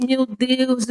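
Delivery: ordinary delivery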